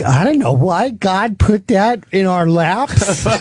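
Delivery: high, slow, plaintive wife voice; wife impression. Born-again wife puzzling over God's plan, high plaintive voice. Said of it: "she's like".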